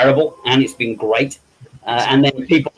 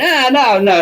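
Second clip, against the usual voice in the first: высокое смущённое «нет, нет»; lightly voiced modest protest; flustered denial; 11 semitones higher, 3 dB louder, slower than usual. Modestly denying he can act.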